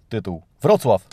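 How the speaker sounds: ordinary talk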